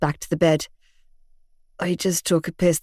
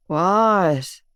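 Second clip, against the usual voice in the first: lazy drawl. A narrator's reading. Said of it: "he drawled lazily".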